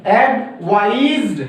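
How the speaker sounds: एडवाइज्ड said very slowly, z sound drawn out; teacher spelling out the z ending slowly; slow z demo. Shown as a demonstration. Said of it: "यहाँ पे एडवाइज".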